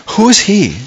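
high-pitched dismissive question; indignant disbelief, rising high then dropping; scornful challenge. Retold or imitated. Acting out David scorning the giant.

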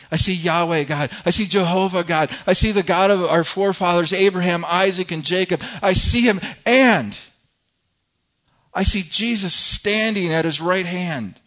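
loud, fervent declaration as Stephen; bold proclamation. Acted. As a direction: proclaim the vision to a hostile crowd. Acting Stephen proclaiming his vision.

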